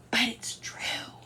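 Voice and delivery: slow, hushed admission; soft, drawn-out whispered aside; confiding whisper. Real, not acted. Confiding after joking.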